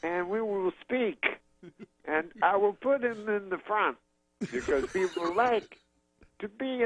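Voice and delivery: high, slow, halting comedian impression; Bill Cosby. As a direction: parcel it out in stretched fragments. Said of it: "And so then he says".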